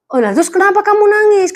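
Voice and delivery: high, surprised mum asking; mother asking her crying son why. Acted. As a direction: play the puzzled mother. Mother questions crying child.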